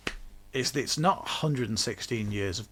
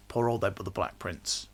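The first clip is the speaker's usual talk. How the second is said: normal talking voice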